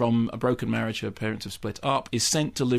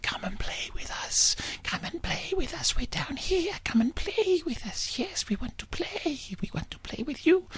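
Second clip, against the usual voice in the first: high eerie ghostly whispering; creepy whisper; 6 dB quieter than usual. Acted. To the girl from the basement, creepy high whisper. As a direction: breathe it like little creatures luring her. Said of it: "whispering voices".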